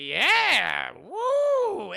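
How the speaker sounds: loud whoop, voice leaping up on the woo; mock crowd cheer. Storyteller voicing villagers at a dance, mock cheering. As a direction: cheer like an imitated festival crowd.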